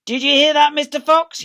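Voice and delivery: loud high yell down the hole; yelling Bean. Acted. Bean shouting down the fox's hole. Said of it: "yelled Bean".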